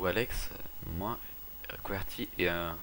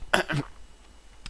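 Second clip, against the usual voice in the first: cough; 8 semitones higher, 4 dB louder than usual. Real, not acted. Cough mid-sentence.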